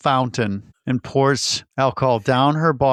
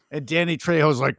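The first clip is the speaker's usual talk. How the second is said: usual speaking voice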